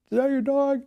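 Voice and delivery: high-pitched mock holler, held back in volume; imitated distant yell. Neighbor calling to a dog's owner from his yard, imitated yell. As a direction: call out high, as if across a yard. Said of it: "this guy outside in the yard yelling".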